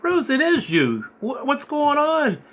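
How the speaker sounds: high, slowed, worried questions; retelling confronting a friend who won't answer, high and slow; alarmed recognition. Retold or imitated. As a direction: realize it's him and ask, unsettled.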